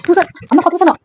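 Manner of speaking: high, insistent plea; urgent pleading. Real, not acted. Wife calling upset husband to listen, urgent pleading.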